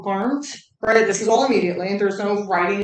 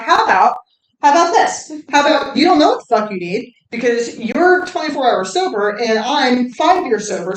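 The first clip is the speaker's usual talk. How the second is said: loud, raised-pitch mock telling-off; retold scolding; 3 semitones higher, 6 dB louder than usual. Staff to a newcomer in treatment, sternly scolding. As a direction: lay down the law, imitating a scolder.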